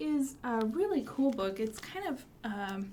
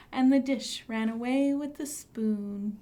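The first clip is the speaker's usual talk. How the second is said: sing-song nursery rhyme; 5 dB louder than usual. Acted. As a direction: lead the kids through the rhyme. Rhyme said again with kids.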